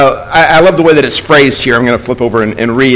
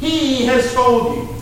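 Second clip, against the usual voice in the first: slow, higher, drawn-out "He"; stress on He; 6 semitones higher, 5 dB quieter, slower than usual. Shown as a demonstration. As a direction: stretch out "He" to make it the point. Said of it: "I wanna emphasize that word".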